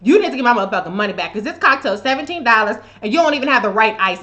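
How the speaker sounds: retold complaint. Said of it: "I was like".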